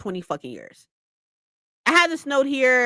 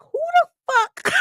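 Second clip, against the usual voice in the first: high, slow who the fuck; drawn-out disbelief; incredulous; slower than usual. Retold or imitated. Answering strangers about beach living, incredulous.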